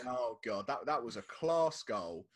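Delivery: usual speaking voice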